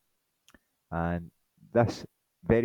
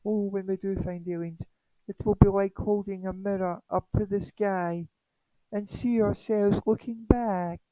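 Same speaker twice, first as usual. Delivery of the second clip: high, sing-song parody scientist; Jill Tarter; 11 semitones higher than usual. Acted. SETI chair musing on meeting aliens, mock high voice. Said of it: "this is Jill Tarter, right, Seti chair".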